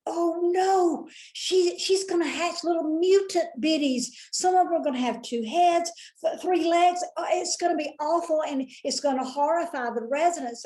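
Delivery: high, fast, alarmed inner voice; picturing two-headed chicks in alarm; panicked thought. Retold or imitated. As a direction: spiral into horrified worry. Panicked thought about mutant chicks.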